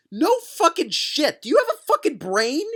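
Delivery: high, incredulous rant; exasperated yell. Acted. Reader berating Chris over an obvious remark, exasperated yell. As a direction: rail at the author as an idiot.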